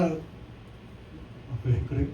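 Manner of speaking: normal talking voice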